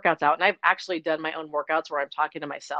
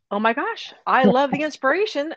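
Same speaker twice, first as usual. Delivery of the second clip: high-pitched delighted exclamation; gushing fan; 8 semitones higher, 5 dB louder than usual. Acted. Coach voicing a happy tribe member, gushing. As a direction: gush it like a thrilled new member.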